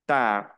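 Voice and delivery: French "tard" trailing on its open vowel; silent final D. Shown as a demonstration. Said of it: "La D final no se pronuncia".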